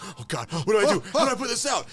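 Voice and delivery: high, rising panic; mock-frantic cry for help; panicked cook. Cook panicking over the fire. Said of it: "He's like".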